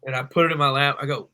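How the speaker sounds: ordinary talk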